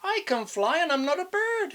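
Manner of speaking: high, squeaky bat; cheeky high bat voice; high little bat. Acted. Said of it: "he said".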